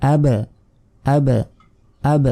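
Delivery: "আব" with the final b pushed and echoed; qalqalah bounce. Shown as a demonstration. Teacher drilling the echo rule, bounced b in "আব". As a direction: release the b of "আব" like "abe", pausing between repeats. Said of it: "ধাক্কা বা প্রতিধ্বনির মতো উচ্চারণ হবে".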